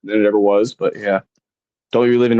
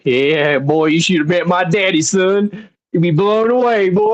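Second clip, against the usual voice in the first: Southern drawl climbing higher and higher; hammed-up Southern accent; 7 semitones higher, slower than usual. Bragging about daddy after accent compliment, hammed-up Southern accent. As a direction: play up a country Southern boast, pitch rising. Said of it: "I love your southern accent, H B".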